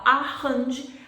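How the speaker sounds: "arrange" with an H sound for the R; breathy H replacing the R in "arrange". Shown as a demonstration. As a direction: mispronounce "arrange" as "a-hanji". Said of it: "você está falando uma palavra com H".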